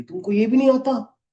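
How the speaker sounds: high, loud scornful jab; mocking the HR's yell. Retelling how the HR lady yelled at him, mock angry shout. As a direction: throw it back the way the boss shrieked it. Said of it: "मेरे को चिल्ला रही".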